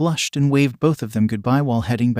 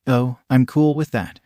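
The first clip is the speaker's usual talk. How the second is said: ordinary delivery, nothing marked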